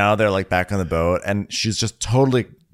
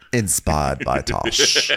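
slow, low mock announcement; 4 semitones lower, much slower than usual. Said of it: "He'd be like".

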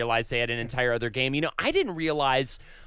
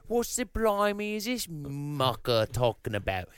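mock British; 3 dB quieter than usual. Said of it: "'cause he was probably British".

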